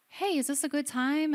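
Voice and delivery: high, chirpy imitation of a visitor; cheery visitor. Guitar lady at the hospital door, cheery. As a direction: pop in with a sing-out, high-pitched greeting.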